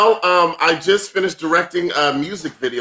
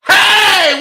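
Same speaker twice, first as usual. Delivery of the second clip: high, loud call-out; shouted happy hello; greeting shout; 8 dB louder than usual. Real, not acted. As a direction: call out to a friend. Greeting friend in chat.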